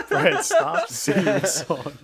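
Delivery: high, loud and drawn out through laughter; laughing protest. Real, not acted. Telling Brett to stop singing the song, laughing loudly. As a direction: beg a friend to stop while cracking up.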